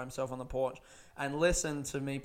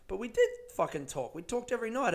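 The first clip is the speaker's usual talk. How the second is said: usual speaking voice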